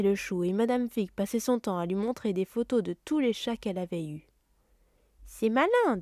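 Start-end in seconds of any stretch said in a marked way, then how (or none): 5.2-6.0 sharp, raised mock fury; furious aunt. Aunt Petunia glaring at Harry, sharp and cross. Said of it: "jetant un regard furieux".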